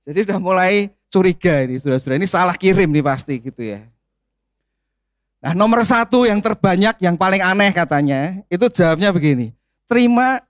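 ordinary talk, nothing marked

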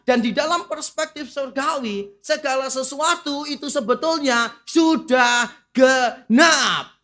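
loud, emphatic sermon delivery; full-force preaching. Acted. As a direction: proclaim it to the whole congregation. Preaching returns.

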